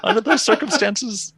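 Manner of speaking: put-on joking about the kid's curse, as a pompous judge; mock judge. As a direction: hand down the verdict like a TV judge.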